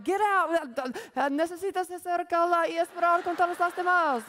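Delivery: high, loud call to the dog; frantic calling. Retold or imitated. The boy yelling desperately at his dog, high and loud. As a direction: try everything to call him back.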